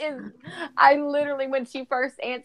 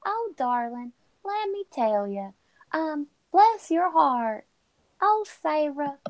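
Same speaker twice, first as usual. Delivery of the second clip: a slow Southern accent, fondly teasing; thick Southern accent; 7 semitones higher than usual. Shown as a demonstration. Southern woman showing off her accent to Sarah, playful. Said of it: "I can make it thick".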